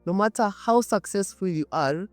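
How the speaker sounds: ordinary delivery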